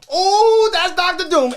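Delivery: very high, loud fanboy yell; hammed-up, high excited cry; retold thrilled outburst. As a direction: shout it like a thrilled fan. Retelling own thrilled reaction.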